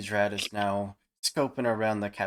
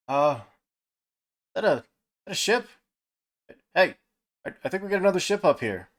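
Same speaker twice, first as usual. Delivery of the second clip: shouted call from the crow's nest; 8 semitones higher, 5 dB louder, much faster than usual. Acted. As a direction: call down, high and loud. Lookout calls down about ship.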